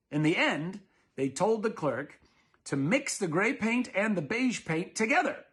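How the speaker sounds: ordinary delivery, nothing marked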